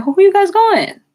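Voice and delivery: high, loud nosy-mom voice. Retold or imitated. Imitating her mom prying about where they're going, high and loud. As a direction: pitch up into a prying mother. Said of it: "So she's like".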